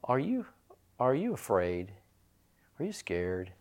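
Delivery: soft, hushed asking; gentle question. Retold or imitated. Boy asks his father softly, hushed. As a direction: ask quietly, almost under breath. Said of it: "He said".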